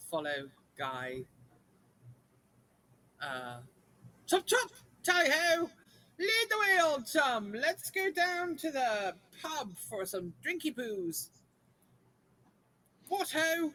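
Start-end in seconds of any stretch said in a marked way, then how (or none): 4.3-13.7 higher, louder hammed-up English toff; chirpy upper-class Brit, chop chop; posh British accent. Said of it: "just stick with the British thing".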